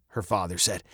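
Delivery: plain reading voice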